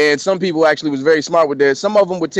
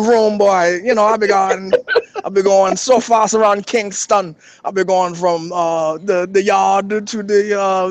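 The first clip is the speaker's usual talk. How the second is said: hammed-up Jamaican accent, pitched higher; Jamaican car thief; 4 semitones higher than usual. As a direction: boast in a put-on Jamaican patois. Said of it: "here's my Jamaican, uh, uh".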